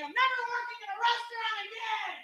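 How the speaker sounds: flailing meltdown. Retold or imitated. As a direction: wail it as if from the floor, high and distant. Wailing while flailing on the ground.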